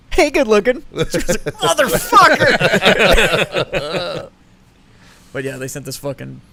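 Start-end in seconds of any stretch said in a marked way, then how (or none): 0.1-0.8 high, loud catcall through a speaker; retold startling call. Husband startling his wife at the door, loud mock catcall. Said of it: "I scared the fuck out of my wife". 1.5-4.3 loud, high, drawn-out mock scream; retold startled scream. Imitating his wife scared at the door, startled drawn-out yell. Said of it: "I scared the fuck out of my wife".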